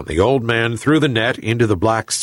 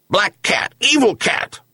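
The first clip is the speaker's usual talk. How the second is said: high-pitched yelp of a talking dog; 10 semitones higher than usual. A narrator's reading. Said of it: "yelped Bully".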